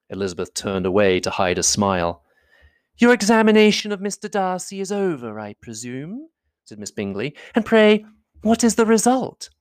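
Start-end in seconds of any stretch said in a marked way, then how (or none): 3.0-9.5 higher, arch and mocking lady; arch Miss Bingley. Miss Bingley to Elizabeth, mocking her examination, archly. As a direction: needle her with sweetness.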